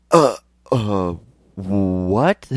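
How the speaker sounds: loud, stammering shock; flabbergasted, halting and loud; stunned disbelief. Retold or imitated. Stunned reaction to being sent for.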